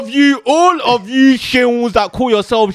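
slow, loud, high hellfire sermon; mock preacher. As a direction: thunder it like a preacher. Mock sermon condemning name.

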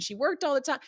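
high mock complaint from a grown-up daughter; imitating her daughter. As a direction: pitch it way up, playful teen grumble. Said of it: "going like".

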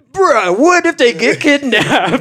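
high, drawn-out mock alarm; retold alarmed protest. Friend scolding a buddy who left the girls' van, retold alarm.

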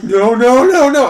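loud, high mock-panicked cries; hammed-up pleading as disaster strikes; acted panic. Acting out others panicking at snap.

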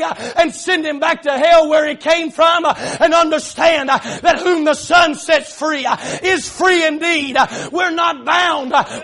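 loud, driving sermon delivery; full-force preaching. Real, not acted. Preacher urging God's children to stand free, full-force preaching. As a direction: preach it hard, punching each phrase.

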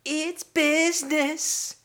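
high, slow crooning bedroom voice; high slow-jam croon of Jermaine; Jermaine impression. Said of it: "can you do Jermaine or Brett?".

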